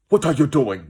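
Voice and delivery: mock rigid African accent. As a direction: clip each word hard like a strict uncle. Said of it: "they don't have that rigid".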